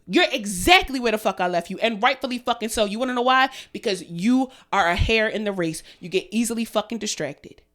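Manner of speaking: normal talking voice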